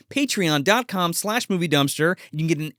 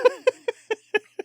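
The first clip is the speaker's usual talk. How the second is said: high laugh. Real, not acted. Laughing at the joke.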